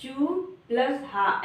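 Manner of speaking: teacher sounding out चू plus हा; spelling it out slowly. Shown as a demonstration. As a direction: sound out चू and हा separately, then join them. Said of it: "ऐसा पढ़ेंगे".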